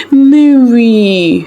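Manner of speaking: high, loud call of a name; rushing to a bedside, calling out; exclaiming. A narrator's reading. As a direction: cry out her name in alarm. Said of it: "she exclaimed".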